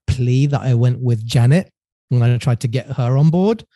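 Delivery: ordinary delivery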